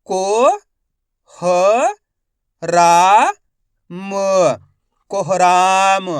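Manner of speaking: slow spelling drill, each syllable held with a rising end; stretched syllables for beginning readers; letter-by-letter sounding out. Shown as a demonstration. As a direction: sound out each letter, then blend the word. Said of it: "एक अक्षर का उच्चारण करके".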